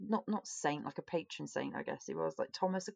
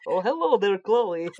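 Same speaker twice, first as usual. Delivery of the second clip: bad Russian accent; 10 dB louder, slower than usual. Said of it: "That was really bad".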